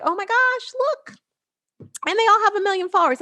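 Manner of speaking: mock hype. Coach mocking million-follower hype, high gushing mock excitement. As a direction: squeal like a hype influencer.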